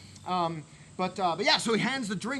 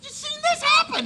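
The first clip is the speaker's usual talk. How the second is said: high squeak; 6 dB louder than usual. Retold or imitated.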